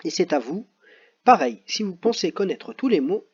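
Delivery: ordinary talk, nothing marked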